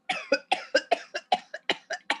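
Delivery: long run of rapid mock coughs; convulsive cough imitation. Shown as a demonstration. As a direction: demonstrate a whooping-cough fit, cough after cough. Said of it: "es una tos convulsiva, que está". (imitación de tos convulsiva)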